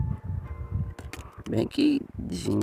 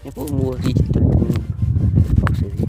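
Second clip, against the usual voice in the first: straining, aching back; groaning through a sore back; sore muscles; 3 semitones higher, 8 dB louder than usual. Real, not acted. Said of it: "Ai, minhas costas".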